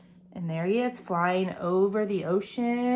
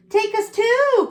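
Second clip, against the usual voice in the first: eager cry, much higher and louder; cried; 11 semitones higher, 6 dB louder, slower than usual. A narrator's reading. Pretty owl begging to come along, eager high cry. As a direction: call out eagerly to be taken. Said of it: "cried the pretty owl".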